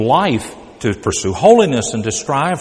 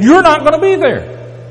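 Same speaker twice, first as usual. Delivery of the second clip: emphatic high warning; 10 semitones higher, 4 dB louder than usual. Acted. Warning listeners they'll miss out.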